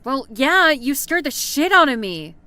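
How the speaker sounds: jumpy and loud; startled, loud and shaken. Acted. Character startled by intruder.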